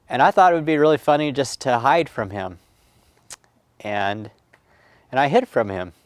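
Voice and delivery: ordinary delivery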